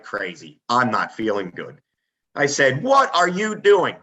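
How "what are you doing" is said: loud, slow, high call over the noise; raised voice over a snowblower. Retold or imitated. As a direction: shout it across the driveway in the storm. Calling out to grandpa in blizzard.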